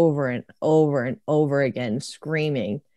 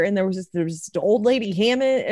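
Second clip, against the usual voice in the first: ordinary talk, nothing marked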